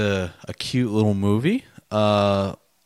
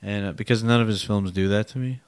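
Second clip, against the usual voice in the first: normal talking voice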